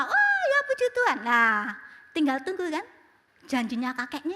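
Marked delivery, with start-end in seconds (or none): 0.0-1.2 high surprised mother's cry of praise; delighted surprise. Retold or imitated. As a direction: gasp it out, thrilled. (kaget gembira bernada tinggi)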